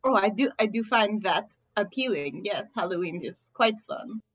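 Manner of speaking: put-on prim, stilted Russian-accented delivery. Merfolk agreeing Halloween is appealing, Russian accent. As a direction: answer formally in a Russian accent. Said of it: "what is that, German?".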